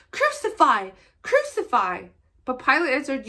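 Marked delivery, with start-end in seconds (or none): 0.1-2.1 loud, high first cry, the second less so; shouting. Acted. Chief priests shout at Pilate to crucify, loud. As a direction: hurl it at the governor. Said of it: "they shouted".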